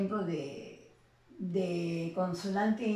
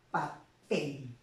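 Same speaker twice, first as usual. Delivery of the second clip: "papel" in two separate syllables, pa... pel, pitched lower; silabeo con énfasis final; 4 semitones lower than usual. Shown as a demonstration. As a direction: break "papel" before its stressed last syllable. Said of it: "la mayor fuerza de voz está en la última sílaba". ("papel" dicho por sílabas: pa... PEL)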